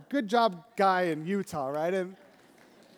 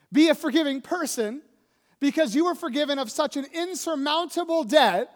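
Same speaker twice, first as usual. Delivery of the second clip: louder, higher pleading charge; exhortation; 7 semitones higher, 7 dB louder than usual. Acted. Preacher to congregation, pleading louder. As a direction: urge them to forgive.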